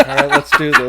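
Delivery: high, loud, laughing mock eagerness; mock eager partner. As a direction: pump it up through laughter. Quoting woman with strap-on.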